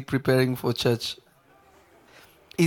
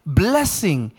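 raised pitch, emphatic pulpit delivery; impassioned preaching; 5 semitones higher than usual. Real, not acted. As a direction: drive each phrase home to the congregation. Preaches at full force.

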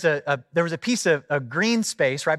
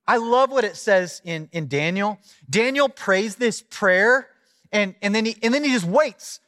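raised-pitch sermon delivery; impassioned preaching; 6 semitones higher than usual. Real, not acted. Preacher tells congregation of Daniel's wait, impassioned. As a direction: preach the Bible story with lifted energy.

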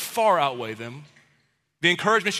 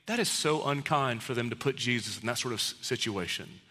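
soft mock piety; 6 semitones lower, 8 dB quieter than usual. Imagined pious disciples' reaction.

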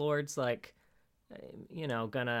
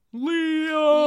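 loud high drawn-out yell; 11 dB louder than usual. Retold or imitated. Raphael yelling out for Leo, long loud yell. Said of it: "Raphael yells out".

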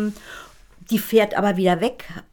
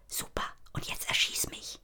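voiceless whisper, much softer; 13 dB quieter than usual. A narrator's reading. Gitti whispers to Rebecca to shoot her. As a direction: breathe it without voice. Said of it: "Gitti leise".

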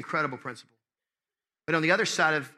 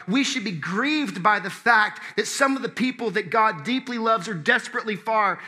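higher, emphatic sermon delivery; impassioned preaching; 5 semitones higher than usual. Real, not acted. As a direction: proclaim it to the congregation with stretched key words.

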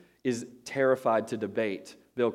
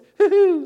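high, loud mock giggle; too-happy giggle; 15 dB louder than usual. Mimicking the girls who are too happy, high mock giggle. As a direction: squeal like an overly happy girl. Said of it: "just too happy, you know the ones".

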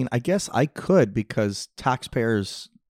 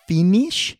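high, louder questioning lady; old masseuse voice; 7 semitones higher, 6 dB louder than usual. Retold or imitated. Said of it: "a sixty-year-old going".

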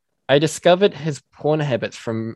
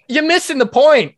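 high, louder exasperated cry; exasperated outburst; 13 semitones higher, 5 dB louder than usual. Real, not acted. Venting at copycat meme accounts, high and exasperated. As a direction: throw up your hands at them.

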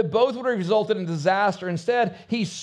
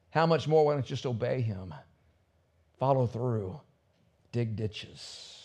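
gentle urging; 8 semitones lower, 7 dB quieter than usual. Retold or imitated. Servant girl urging Naaman softly.

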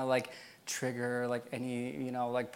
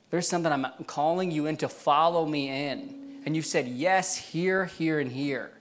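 impassioned preaching; 3 semitones higher, 6 dB louder than usual. Acted. Preacher passing God's call to the community, impassioned preaching. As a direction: press each phrase on the congregation with force.

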